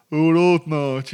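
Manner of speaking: loud, high, exaggerated Brummie; hammed-up Brummie, blaring and drawn out; caricature Birmingham accent. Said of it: "the Birmingham accent was, like, associated with".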